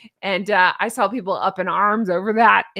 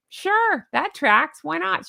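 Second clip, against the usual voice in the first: sarcastic; 7 semitones higher, slower than usual. Acted. Mocking sarcastic agreement.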